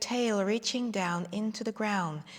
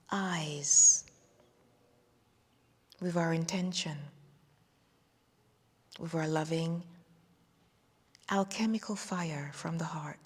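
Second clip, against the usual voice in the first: whispering; 3 semitones lower than usual. Acted. Meditation release, voice drops to whisper.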